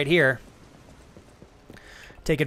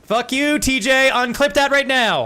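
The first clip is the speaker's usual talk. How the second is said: higher, louder mock outrage; mock angry yell; 10 semitones higher, 7 dB louder, slower than usual. Yelling at TJ to unclip the moment, in mock anger. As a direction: yell it at the clipper, half joking.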